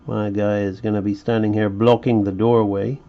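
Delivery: usual speaking voice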